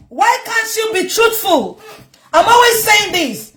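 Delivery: slow, high, scolding shout; exasperated shout. Real, not acted. Speaker scolding the girl, high exasperated shout. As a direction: demand the truth, exasperated.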